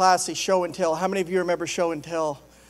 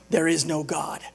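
lower-pitched, matter-of-fact gloat; smug retort; 4 semitones lower, 3 dB quieter than usual. Acted. Atheist who bought the groceries, smug low retort. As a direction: drop the pitch to settle the point.

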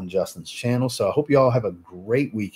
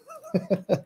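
embarrassed laugh; 3 dB quieter than usual. Real, not acted. Laughs at his own mistake.

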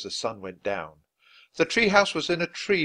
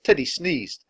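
plain reading voice

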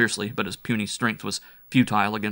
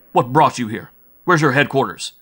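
loud, higher demands; scolding interrogation; 4 semitones higher, 9 dB louder than usual. Acted. Bull Mike questioning the captive, loud scolding. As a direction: fire questions sternly at the prisoner. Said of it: "scolded Bull Mike".

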